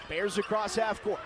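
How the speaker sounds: normal talking voice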